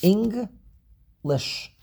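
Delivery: "English" split into Eng, lish; slow teacher's split of "English"; syllable by syllable. Shown as a demonstration. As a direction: count out "English" in two beats with a pause. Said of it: "Two syllables".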